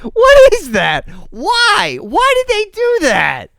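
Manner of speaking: real incredulous outburst at the screen; baffled high-pitched squawk of disbelief; shocked disbelief. Real, not acted. Reviewer shocked by stepmom's look.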